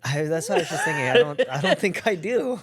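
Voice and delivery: giggling through a self-mocking admission; laughing. Real, not acted. Laughing at the baby joke.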